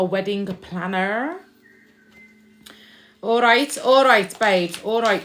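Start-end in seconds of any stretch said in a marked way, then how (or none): 3.2-5.2 louder and higher, hurrying along; excited rushed all right. Real, not acted. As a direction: blurt it out as if being hurried. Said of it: "they're rushing me".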